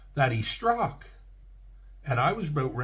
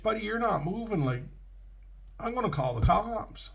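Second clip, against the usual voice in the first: higher, heated retold warning; mock angry threat; 8 semitones higher than usual. Angry trucker warns the crashed driver he'll call cops. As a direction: snap at him that you're calling the cops.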